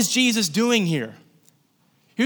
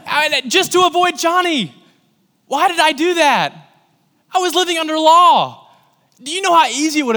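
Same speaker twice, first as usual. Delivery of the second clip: high-pitched, incredulous self-reproach; pitched way up, exasperated; exasperated outcry; 8 semitones higher, 4 dB louder than usual. Real, not acted. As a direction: exclaim in disbelief at yourself. Berates himself over avoiding his friend.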